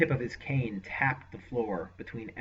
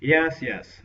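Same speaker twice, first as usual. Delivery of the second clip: dismissive; 4 semitones higher, 10 dB louder than usual. Acted. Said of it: "Malastair dismissed his concerns".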